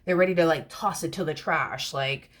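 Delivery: usual speaking voice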